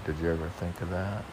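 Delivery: ordinary talk, nothing marked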